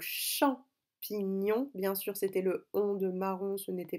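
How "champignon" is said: champignon very slowly, cham-pi-gnon; syllable by syllable. Shown as a demonstration. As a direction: split champignon into three drawn-out syllables. Breaking the word into syllables. (champignon découpé lentement en syllabes)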